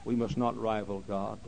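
ordinary talk

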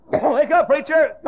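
high, loud cry with a laugh in it; urgent call. Retold or imitated. Holy Spirit waking the preacher, loud high call. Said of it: "But anyway".

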